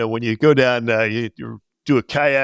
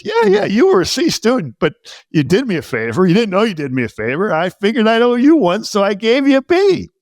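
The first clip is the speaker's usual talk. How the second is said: put-on high, cheerful old teacher explaining; imitating the teacher; 9 semitones higher, 4 dB louder than usual. Teacher explaining the generous grade, higher. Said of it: "He goes".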